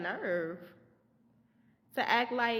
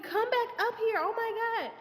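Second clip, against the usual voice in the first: very high-pitched panicked call; squealed mock alarm; retold frantic yell; 13 semitones higher than usual. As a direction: re-enact yelling down at a kid to climb back. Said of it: "So I'm screaming".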